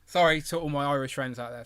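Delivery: ordinary talk, nothing marked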